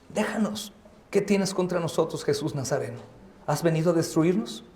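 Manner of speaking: very soft, restrained reading; hushed quotation. Acted. Preacher quoting the demoniac's words to Jesus, hushed.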